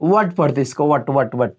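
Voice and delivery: "what" said as वट with no h; drilling the right way to say what; correct what. Shown as a demonstration. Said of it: "हवाटा पढ़ेंगे क्या कि what पढ़ेंगे".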